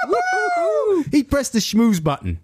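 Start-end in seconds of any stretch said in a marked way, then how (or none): 0.1-1.0 high-pitched whooping cheer; giddy, very high woo-hoo. Real, not acted. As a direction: whoop with delight at the joke. Whooping at co-host's joke.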